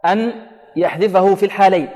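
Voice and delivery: normal talking voice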